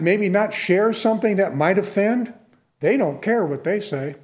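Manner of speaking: usual speaking voice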